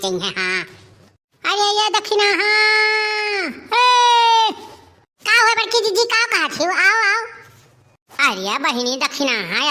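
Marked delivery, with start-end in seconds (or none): 1.4-4.5 high, loud, long-drawn holler; stretched shouted summons; calling out. Acted. Calling neighbour from afar. 5.2-8.3 high, loud answering call; drawn-out come-here call; calling back. Acted. Neighbour calls back.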